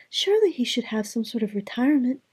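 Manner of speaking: put-on high, bright young princess voice; cartoon princess. A narrator's reading. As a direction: play a sweet storybook princess. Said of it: "the princess said".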